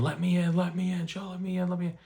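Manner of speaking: imitating a mother screaming to be let in; imitated frantic screaming.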